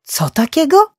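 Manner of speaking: stunned, high, loud question; thrown off balance. A narrator's reading. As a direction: blurt it out in shocked disbelief. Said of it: "kompletnie wytrącony z równowagi".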